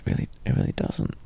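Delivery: quick whispered aside. Acted. Girlfriend telling her partner off about the phone light, whispered fast. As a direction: rush it out under your breath.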